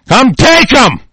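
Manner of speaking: a real, furious battle cry; defiant shout. Real, not acted. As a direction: hurl it as a dare.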